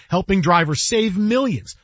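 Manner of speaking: normal talking voice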